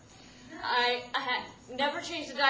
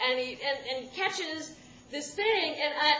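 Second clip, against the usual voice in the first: usual speaking voice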